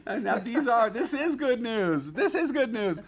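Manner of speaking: giggling high through the good news; laughing. Real, not acted. Delighted streamer laughing to chat about kitten's poop, giggling.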